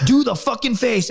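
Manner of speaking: high, drawn-out mock order; insistent imitation of an instructor; drill instructor. Drill instructor demanding the face again, high slow order. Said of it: "just start screaming".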